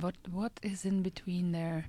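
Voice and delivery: whispered to herself; whispering. Real, not acted. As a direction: whisper the question under breath. Wondering to herself.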